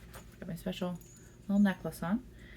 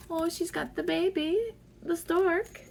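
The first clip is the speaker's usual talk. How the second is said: delighted; 9 semitones higher than usual. Real, not acted. Delighted at a card.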